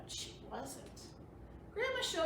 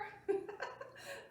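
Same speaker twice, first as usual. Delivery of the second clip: soft laugh; 8 semitones higher, 4 dB quieter than usual. Real, not acted. Laughs at joke.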